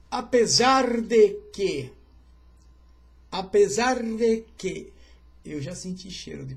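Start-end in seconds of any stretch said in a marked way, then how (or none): 0.1-4.9 much louder and slow, each word weighed; slow emphatic build-up. Real, not acted. Preacher building suspense, loud and slow. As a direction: pastor stretches the phrase to hold the room, slow and loud.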